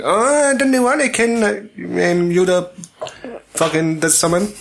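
high, complaining character voice; Big Jimmy. Acted. Co-host as character hating spoiled films, Big Jimmy impression. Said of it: "So then he go like".

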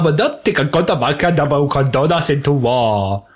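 garbled stilted queen voice; mock the stiff regal delivery into near gibberish; put-on mumbled royal speech; Natalie Portman. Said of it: "Natalie Portman's voice in this".

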